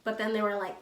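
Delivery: ordinary talk, nothing marked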